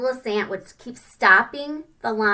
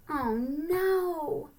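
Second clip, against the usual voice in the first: ordinary delivery, nothing marked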